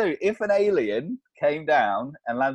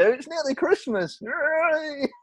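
put-on squeaky high childish cheer; high, squeaky, over-the-top Christmas yay; mock excitement; 12 semitones higher, much slower than usual. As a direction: fake over-the-top festive glee.

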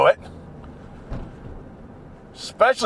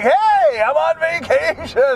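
cheery tourist; 10 semitones higher than usual. Retold or imitated. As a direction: mimic the giddy visiting relatives. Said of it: "they're like".

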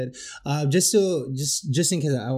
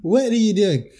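much higher, louder mock girlfriend voice; 10 semitones higher, 5 dB louder than usual. Retelling his girlfriend telling him off, high mock voice. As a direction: squeak out her exasperation in a high voice. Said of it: "It's like".

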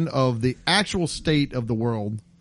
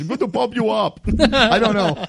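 put-on Austrian accent; 8 semitones higher, 3 dB louder than usual. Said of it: "Hans de France".

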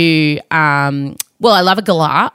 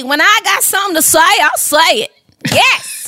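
put-on high Southern accent, [name]; sing-song Southern twang; 9 semitones higher than usual. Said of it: "Because [name] would say".

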